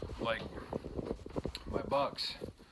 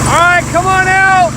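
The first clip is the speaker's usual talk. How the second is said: calling out; 25 dB louder than usual. Real, not acted. Calling kids outdoors.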